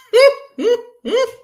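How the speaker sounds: high, loud burst of laughter; real delighted laugh. Real, not acted. Laughing at the nickname.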